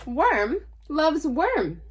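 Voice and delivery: ordinary delivery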